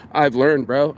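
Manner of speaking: ordinary talk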